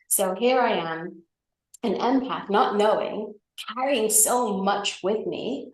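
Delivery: ordinary talk, nothing marked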